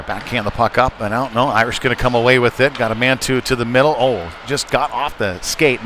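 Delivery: ordinary talk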